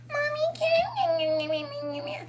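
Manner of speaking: put-on very high, tiny pleading kid; sweet child voice. Imitating a child sweetly begging mommy, very high. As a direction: squeak it like a little kid asking mom. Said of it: "you think they talk like that at school?".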